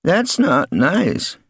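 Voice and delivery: put-on slow, unhurried old man; calm old man. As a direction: say it without turning round. Mister Thrips speaks.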